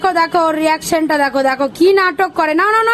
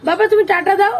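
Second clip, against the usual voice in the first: ordinary delivery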